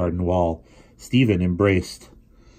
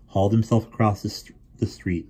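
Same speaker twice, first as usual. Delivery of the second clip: plain reading voice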